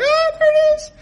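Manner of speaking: like a choir just sang; awed discovery. Retold or imitated.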